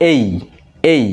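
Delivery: এই as one gliding "ei"; modelled "ay" sound. Shown as a demonstration. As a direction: say এই as the diphthong in English "ay". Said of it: "এখানে উচ্চারণটা আমরা করব".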